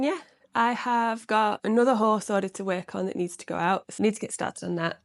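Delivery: ordinary delivery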